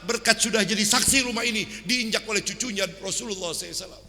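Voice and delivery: fervent prayer. Acted. Sheikh thanking God loudly for the honoured guest.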